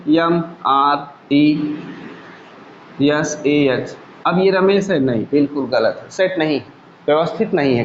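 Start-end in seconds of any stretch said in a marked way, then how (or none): 0.1-4.0 slow, letter-by-letter spelling; slow spelled-out letters in scrambled order. Shown as a demonstration. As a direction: spell each letter out slowly and separately. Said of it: "अब इसमें क्या कर दीजिए".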